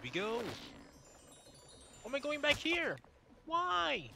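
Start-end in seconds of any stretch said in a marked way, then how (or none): 2.0-4.1 high, exasperated questioning; baffled protest. Real, not acted. Player sent back in the game, high baffled protest. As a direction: whine upward at the game in bafflement.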